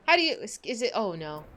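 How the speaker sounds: ordinary talk, nothing marked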